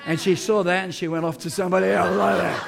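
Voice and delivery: ordinary talk, nothing marked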